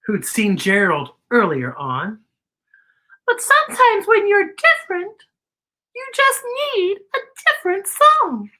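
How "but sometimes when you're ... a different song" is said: a tiny, polite cricket clearing his throat. A narrator's reading. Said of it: "Cricket said".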